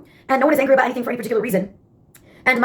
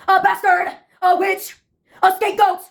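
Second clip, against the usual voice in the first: put-on high-pitched theater-kid outburst; theatrical scream; 5 semitones higher than usual. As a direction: play it like a melodramatic musical chorus. Recurring theater-kid chorus.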